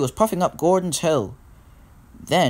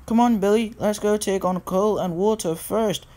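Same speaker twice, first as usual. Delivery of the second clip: high-pitched cheerful urging; lifted, encouraging storybook engine; urging Thomas; 6 semitones higher than usual. A narrator's reading. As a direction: coax a friend along in a higher voice. Thomas urging Billy along.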